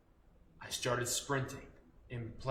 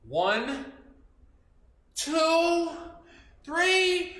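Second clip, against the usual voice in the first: building count; 12 semitones higher, 6 dB louder, much slower than usual. Shown as a demonstration. Kids' teacher counting up to the pounce, building. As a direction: count up rising, louder each number. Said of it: "pounce up on your grown-up here".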